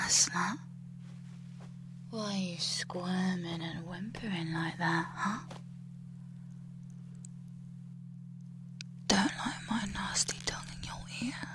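soft, close whispered taunts; menacing whisper. Acted. Captor whispering cruel taunts into the captive's ear.

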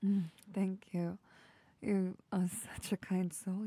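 usual speaking voice

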